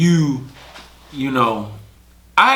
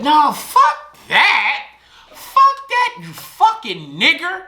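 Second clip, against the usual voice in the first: much higher slow furious woman's voice; mock angry woman; slower than usual. Girlfriend yelling slurs, acted.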